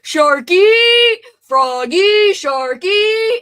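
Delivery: SHARKY and FROGGY called out high and loud, endings lifted; playful rising inflection. Shown as a demonstration. Streamer trying out fun nicknames on a viewer, playful rising inflection. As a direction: sing out SHARKY and FROGGY loudly, stretching the final ee upward. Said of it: "that kind of inflection".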